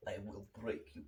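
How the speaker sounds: low, soft Swedish action hero; a hint of a Swedish tough guy; Swedish accent. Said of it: "what's Dolph Lundgren sound like? Swedish".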